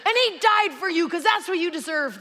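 loud, high argumentative yell; screaming match. Acted. Norm preaching at the stranger, screaming. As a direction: shout it like an accusation. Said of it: "they begin a screaming match".

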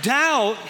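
"Doubt" shouted high and loud; stress on Doubt. Real, not acted. Pastor hammering the word "Doubt" to the congregation. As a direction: hit "Doubt" hard and high.

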